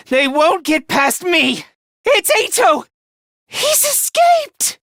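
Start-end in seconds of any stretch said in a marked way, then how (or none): 2.0-4.8 very high cry of alarm; panicked alarm. Acted. Fighter warning team that Eito escaped, panicked. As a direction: raise the alarm, voice shooting up.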